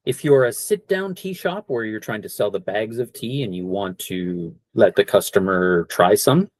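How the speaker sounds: ordinary talk, nothing marked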